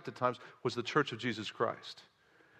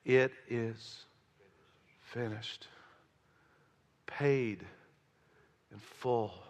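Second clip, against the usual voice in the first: dying Jesus; 5 semitones lower, 4 dB quieter than usual. Acted. Jesus's words on the cross.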